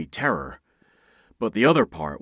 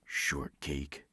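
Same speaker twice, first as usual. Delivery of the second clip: soft teasing whisper; smirking whisper; 6 semitones lower, 13 dB quieter than usual. Acted. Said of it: "he smirked and whispered".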